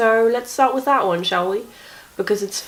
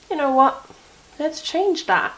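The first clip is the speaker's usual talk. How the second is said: mock-cheeky body voice, pitched up; voicing her body; 7 semitones higher than usual. Body teasing her with a new illness, pitched-up mock voice. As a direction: her body plotting mischief. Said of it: "so my body said".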